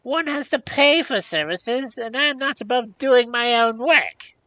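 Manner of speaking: high, loud, cross crone; cross old hag. A narrator's reading. Hag refusing offered services, cross. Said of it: "interrupted the hag crossly".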